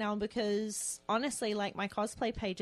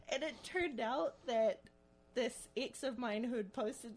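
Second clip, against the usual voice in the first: normal talking voice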